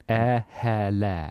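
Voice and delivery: "Air hair lair" drawn out slowly as a posh "oh hello there"; posh accent. Shown as a demonstration. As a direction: stretch it out like a posh English toff. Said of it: "makes you sound posh".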